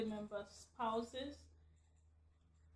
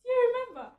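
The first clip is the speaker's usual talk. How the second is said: excited; 12 semitones higher, 9 dB louder, much faster than usual. Real, not acted. Asking husband.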